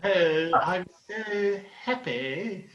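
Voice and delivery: exaggerated posh royal English; posh Queen's English. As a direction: put on a drawn-out posh English accent. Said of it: "Queen's English is a bit more posh".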